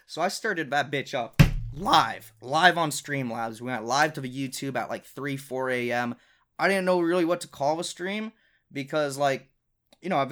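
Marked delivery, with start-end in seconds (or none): none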